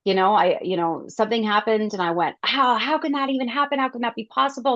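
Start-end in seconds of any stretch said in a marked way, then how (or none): 2.4-4.7 high, alarmed disbelief; freaking out. Retold or imitated. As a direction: retell the panic, pitch up. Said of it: "And I freaked out".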